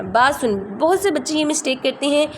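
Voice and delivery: ordinary delivery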